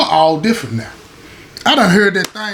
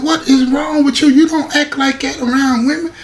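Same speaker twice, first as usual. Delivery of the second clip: higher, incredulous sisterly scold; 8 semitones higher than usual. Retold or imitated. Sister at the visit scolding him for acting strange, high and sharp.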